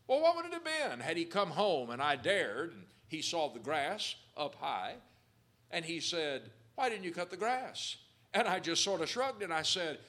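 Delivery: loud, fervent preacher voice; preaching at full force. Acted. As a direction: preach it out to the congregation. Preaching about the dad story.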